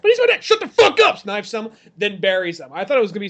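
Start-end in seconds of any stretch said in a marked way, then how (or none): 0.0-0.4 high pleading boy's voice, hammed-up; whiny son. 0.4-1.2 hammed-up furious bark, much higher; mock angry shout. Trapper snaps at the son.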